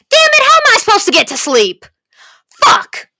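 high, loud frustrated outburst; exasperated shout. Real, not acted. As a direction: yell it in total exasperation. Exasperated rant about giving up meds.

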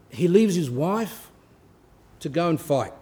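usual speaking voice

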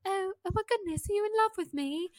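put-on high English-accented ingénue voice; put-on English accent, astonished; Keira Knightley. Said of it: "her accent is way cuter".

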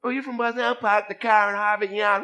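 put-on loud, high-pitched Boston accent caricature; mock Boston accent. People joking on hearing he's from Boston, mock Boston accent. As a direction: tease with an exaggerated Boston accent. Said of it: "do not have a Bostonian accent".

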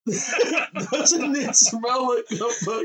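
high, drawn-out words through laughter; cracking up while asking; barely getting it out for laughing; real laughing. Real, not acted. Cracking up over beer's smell.

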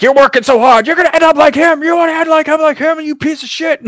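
high-pitched, loud hammed-up tirade; mock shouted berating. Police chief tearing into an officer, mock shouting. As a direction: yell it high like an overblown angry chief.

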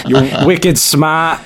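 put-on slow, drawn-out Boston accent. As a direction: lay on a thick Boston accent. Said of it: "a really thick Boston accent".

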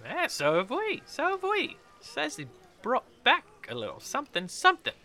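high put-on voice; gossiping tavern character. Villager passing on rumours of the expedition, high gossip. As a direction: pitch it up and share the rumour. Game master plays gossiping pair.

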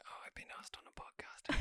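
whispered imitation of inner thought; hushed mock awe. Host voicing the guest asked on a podcast, mock-awed whisper. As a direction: whisper it as if overwhelmed.

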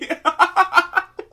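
high, loud burst of laughter; real uncontrollable laugh; giggling fit. Real, not acted. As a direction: break into helpless giggles. Cannot get serious.

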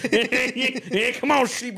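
high, silly shepherd calling his sheep; goofy shepherd voice. Pretend. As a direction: call the sheep in a dopey high voice. Acting as a lowly shepherd.

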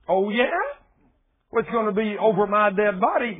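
loud, high, mocking taunt; defiant giant. Giants taunting the Israelites, loud defiant sneer. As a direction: sneer back at the invaders.

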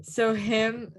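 normal talking voice

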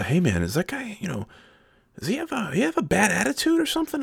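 lightly hammed friendly kid; high soft mock voice. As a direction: ask it in a light squeaky voice. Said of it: "He's like, uh".

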